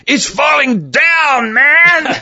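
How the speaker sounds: high, slow Jose Jimenez drawl; Jose Jimenez accent. Retold or imitated. [name] answering the DJ, in Jose Jimenez accent. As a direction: drag it out deadpan in the comic accent. Deadpan comic punchline.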